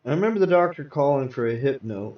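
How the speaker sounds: ordinary delivery, nothing marked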